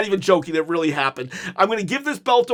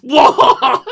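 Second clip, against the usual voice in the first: loud wordless bellow, imitating fury; hammed-up tongue-tied outburst, getting louder; mock speechless rage; 7 dB louder than usual. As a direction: play too mad to speak. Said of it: "Cody can't even speak. He's so mad".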